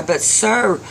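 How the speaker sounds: put-on high-pitched child's plea; beg, with a touch of crying; tearful pleading. A narrator's reading. Little boy begs old man for soup, tearful. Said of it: "the little boy begins to cry".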